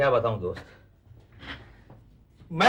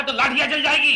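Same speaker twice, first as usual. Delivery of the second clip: loud, raised-pitch warning; angry threat; 5 semitones higher, 5 dB louder than usual. Acted. Husband threatening wife in a match-making quarrel, loud and heated. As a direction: build to a shouted threat.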